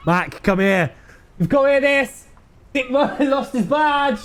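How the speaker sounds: giddy with the joke, laughing; gleeful call. Acted. Cop gleefully calling colleague over.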